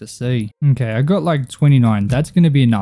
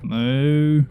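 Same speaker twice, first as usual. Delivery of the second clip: a quick mock moo at the cows; 2 semitones higher than usual. Calling to cows in the field, a light imitated moo. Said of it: "Okay, that was weird".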